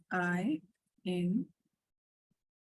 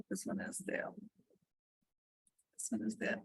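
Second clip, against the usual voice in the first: muttered under breath; 9 dB quieter than usual. Real, not acted. Muttering while checking screen.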